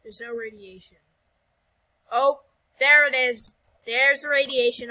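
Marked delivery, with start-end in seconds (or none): 2.1-4.9 loud cry of alarm, much louder than usual; 惊呼报警. Pretend. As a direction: call out the danger loudly. Sudden danger in the game.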